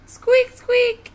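very high, loud squeak; piercing little squeak; little lion's squeak. Acted. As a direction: call out in a tiny squeaky voice. Little lion squeaks again.

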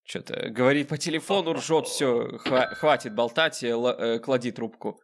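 normal talking voice

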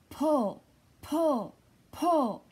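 loud straining cries; straining pull. Acted. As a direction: heave with each word. Ram strains to pull turnip.

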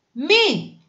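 high, sharp "don't"; teacher suddenly turning fierce; stern teacher's warning. A narrator's reading. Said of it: "αγρίεψε ο δάσκαλος". (αγριεμένη απαγόρευση)

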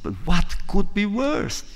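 punchline setup, slow and incredulous; exasperated question. Retold or imitated. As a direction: ask it in mock despair. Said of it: "The first one said".

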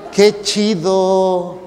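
very slow, stretched Mexico City drawl; chilango singsong. Acted. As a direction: drag out each vowel in mock chilango. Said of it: "Parece que hablan cantadito". (cantadito chilango muy alargado)

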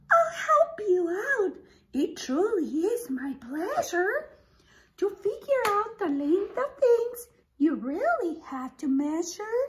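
high, sweet spider voice. Acted. Teacher voicing Spider offering help, high pitched. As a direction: play the helpful spider kindly. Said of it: "then Spider said".

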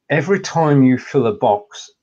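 normal talking voice

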